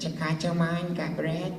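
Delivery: high, small creature voice; cute little critter voice; Guilmon voice. Retold or imitated. Voice actor showing producers a cute little character voice. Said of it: "And I said".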